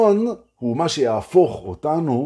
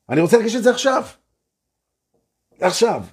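emphatic affirmation; 10 semitones higher, much faster than usual. Shown as a demonstration. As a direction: press it into the now. Building affirmation.

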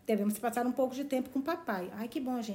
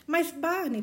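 protesting; 6 semitones higher, slower than usual. Acted. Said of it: "protestou Valancy".

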